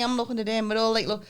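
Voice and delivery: normal talking voice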